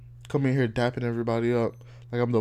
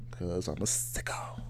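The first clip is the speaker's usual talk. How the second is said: whisper; 5 semitones lower, 9 dB quieter than usual. Real, not acted. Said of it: "Why does your mind go there".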